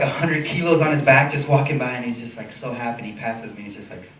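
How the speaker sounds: usual speaking voice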